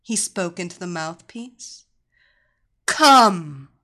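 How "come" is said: very loud single command; commanding word. Acted. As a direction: call him in like a mistress. Mistress's voice on the phone.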